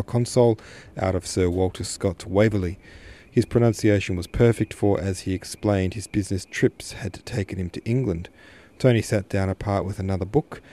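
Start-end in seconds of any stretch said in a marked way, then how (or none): none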